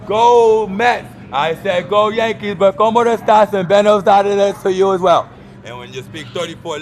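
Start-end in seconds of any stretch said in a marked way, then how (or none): 0.1-5.3 high, loud rallying chant; cheering call. Pretend. Vlogger cheering on the Mets and Yankees, loud high call. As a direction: call it out like a sports fan. Cheering for teams, calling out greetings.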